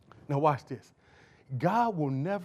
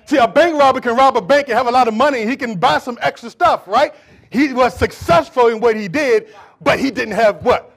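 loud, driving sermon delivery; full-force preaching; 4 semitones higher, 13 dB louder than usual. Acted. Preacher arguing what good success is, full-force preaching. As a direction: press the example home loudly.

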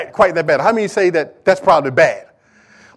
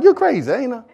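higher-pitched indignant listener; mock disbelief; 5 semitones higher than usual. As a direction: act out a scoffing churchgoer. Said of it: "You look at me like".

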